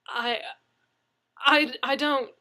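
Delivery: stammering. Acted. Leslie stammering.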